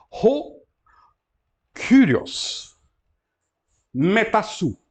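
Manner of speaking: slow, carefully sounded Greek words; Greek pronunciation. Shown as a demonstration. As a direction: say each Greek word slowly and clearly. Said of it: "Está aqui a transcrição". (pronúncia grega lenta e cuidadosa)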